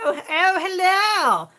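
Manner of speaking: high, loud, plummy posh greeting; a sudden posh telephone hello. Real, not acted. Mother greeting her daughter in a posh voice. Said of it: "That sound come out a bit posh then".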